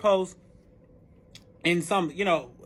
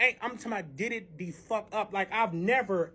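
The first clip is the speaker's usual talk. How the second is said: ordinary delivery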